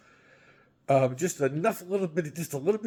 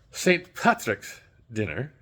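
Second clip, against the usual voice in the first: slow, lower mock Irish brogue on Saint Patrick's dinner; a hammed-up, half-hearted Irish accent; bad Irish accent; 8 semitones lower than usual. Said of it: "bad accent, sorry".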